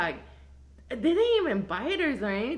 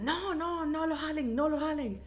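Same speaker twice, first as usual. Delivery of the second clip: higher-pitched, repeated plea to stop; mock urgent father's warning; imitated protesting dad; 6 semitones higher than usual. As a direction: insist like an alarmed dad. Retold dad stopping the kids.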